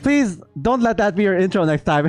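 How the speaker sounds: ordinary talk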